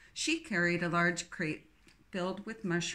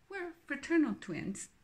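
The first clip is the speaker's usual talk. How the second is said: put-on lightly English accent; 8 semitones higher than usual. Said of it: "her English accent strong".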